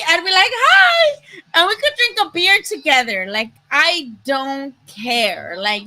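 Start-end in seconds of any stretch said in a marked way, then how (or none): 0.5-1.4 high, bright-eyed hi; chirpy hi. Pretend. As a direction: squeak a cheerful hi. Her own reply imagined.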